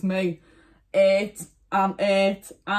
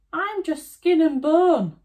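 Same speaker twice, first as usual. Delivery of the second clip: put-on old woman voice; 10 semitones higher, 6 dB louder than usual. Old woman pleads to wolf.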